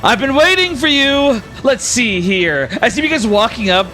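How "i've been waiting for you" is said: high, slow, grand welcome from a showy god; higher, drawn-out showman god; flamboyant god voice. Acted. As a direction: spread your arms and greet them like a theatrical deity. GM voices Rex, god of fate.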